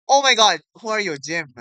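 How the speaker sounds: high loud gasping surprise; shocked shout. Real, not acted. Kid reacting to a surprise character, shouting in shock. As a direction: yell it out, stunned.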